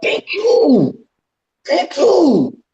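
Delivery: high-pitched excited thank you, hyping him up; hyped thank you. Real, not acted. Co-host cheering the host's bold claim, high and excited.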